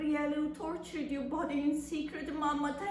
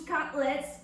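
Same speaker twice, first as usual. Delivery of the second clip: plain reading voice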